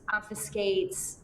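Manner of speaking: ordinary talk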